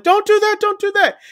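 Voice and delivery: high-pitched retold pleading; mock alarm. Diners beg the officer lowering his gun, mock alarm. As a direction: imitate panicked diners crying out.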